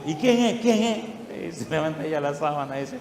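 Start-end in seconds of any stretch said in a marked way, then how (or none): 0.0-1.6 higher, startled who's-there; startled question. Retold or imitated. Brother Emilio startled by the sheet figure, asking higher. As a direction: ask it higher, caught off guard.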